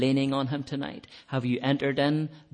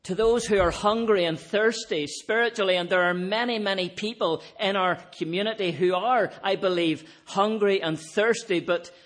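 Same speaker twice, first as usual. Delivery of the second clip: raised, louder impassioned preaching; preaching at full force; 6 semitones higher, 4 dB louder than usual. Acted. Preacher calling out to the hungry and thirsty, impassioned. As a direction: lift the congregation with a ringing appeal.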